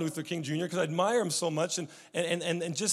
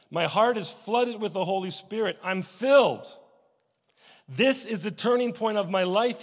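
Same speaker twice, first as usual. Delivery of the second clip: louder, rising mock yell; retold exclamation; 4 semitones higher, 6 dB louder than usual. Young preacher praying at his turning point, retold exclamation. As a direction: exclaim it like a retold breakthrough, without full shouting. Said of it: "he yelled".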